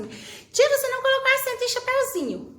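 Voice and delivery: high, quick schoolchild voice; complaining pupil. Acted. Teacher imitating a pupil commenting on a missing accent, complaining kid voice. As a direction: mimic a kid piping up with an objection. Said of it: "vai ter menino que vai mandar no comentário lá assim".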